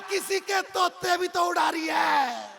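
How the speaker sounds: loud, high and slow retort; slow, loud, high yell; shouted punchline. Acted. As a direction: bellow the comeback. Husband shouts punchline at grandma.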